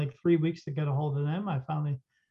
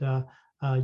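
normal talking voice

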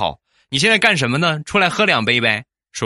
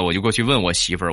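ordinary delivery

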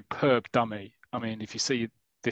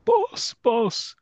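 calling out; 13 semitones higher, 6 dB louder than usual. Retold or imitated. Calling from back of stand.

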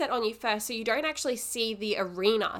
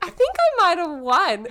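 loud, laughing boast; gloating laugh; 8 semitones higher, 7 dB louder than usual. Real, not acted. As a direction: laugh out a smug win.

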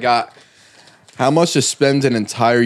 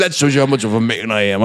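mock deep macho voice; 4 semitones lower than usual. Teased for baby talk, he drops into a mock deep manly voice. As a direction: put on a deep he-man voice, chuckling. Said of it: "Does your voice just get deeper".

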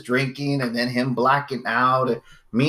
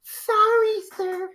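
put-on much higher, slow, laughing girl voice; mock girl voice; much slower than usual. Girl answering the dad, in a mock high voice. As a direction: squeak it like a prim little girl, laughing through it. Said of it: "She turns around".

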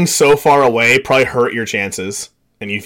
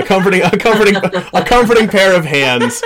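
laughing; 6 semitones higher than usual. Real, not acted. Restarts the line, laughing.

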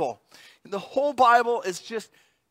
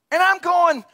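ordinary talk

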